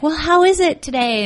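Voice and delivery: a light whiny relative voice; imitating relative. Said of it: "it would be like".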